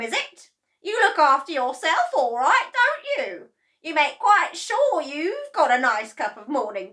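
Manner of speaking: high, loud mocking scold; gloating accusation. A narrator's reading. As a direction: sneer at the selfish boy. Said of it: "she cried".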